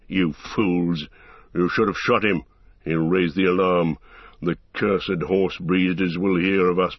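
menacing orc leader voice; gruff orc captain. Acted. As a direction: threaten like a brutal orc captain. Ugluk scolds the scouts.

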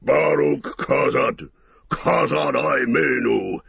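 slow, hoarse dwarf war cry; hoarse shout. A narrator's reading. The dwarf leaping out to save Eomer, hoarse war cry. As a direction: roar it from the shadows. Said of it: "gave a hoarse shout".

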